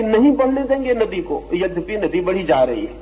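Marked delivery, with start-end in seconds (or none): 0.0-1.4 mock shouting, pitched well up; raised voice, half-shouted defiance; retold defiant shout. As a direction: imitate a tiny straw crying out against the river. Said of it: "चिल्ला रहा है बहुत जोर से".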